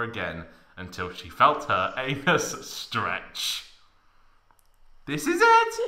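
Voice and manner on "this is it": very high squeak of effort; hammed-up falsetto strain; mock straining Tifa. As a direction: squeal it mid-push. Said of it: "she said aloud while pushing and grunting".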